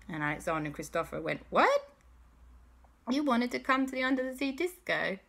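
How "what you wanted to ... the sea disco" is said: high-pitched, rising disbelief; incredulous. Acted. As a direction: ask as if astonished at the villain. Boys react in disbelief.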